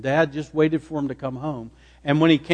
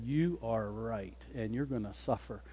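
quiet menace; 4 semitones lower, 16 dB quieter than usual. Acted. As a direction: pronounce their punishment softly and deliberately. Imagined vengeful Joseph threatens his brothers.

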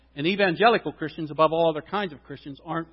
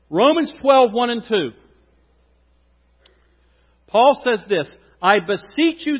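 preaching; 3 semitones higher, 6 dB louder than usual. Acted. Pastor reading Romans aloud, loud preaching. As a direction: proclaim it to the congregation.

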